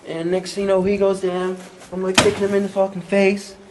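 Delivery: loud, high, breathless fight story; frenzied retelling. Retold or imitated.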